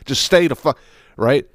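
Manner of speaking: usual speaking voice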